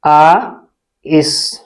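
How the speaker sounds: आइस broken into आ and इस; sounded out. Shown as a demonstration. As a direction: sound out आ, long pause, then इस. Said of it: "इसे हम क्या पढ़ते हैं". (टुकड़ों में तोड़कर आ... इस)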